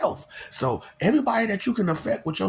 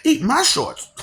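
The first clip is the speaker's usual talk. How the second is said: high, loud cartoon brat; bratty boy catchphrase; Bart Simpson catchphrase; 11 semitones higher, 4 dB louder, slower than usual. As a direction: shout it like a cheeky cartoon kid. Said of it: "Bart Simpson".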